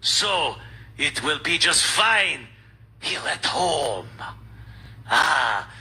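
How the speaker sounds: slow, hammed-up French accent; movie French accent. Host mimics Glucksmann's demand, slow mock French accent. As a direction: stretch it out in cartoon French accent. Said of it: "I just do the accent like in movies".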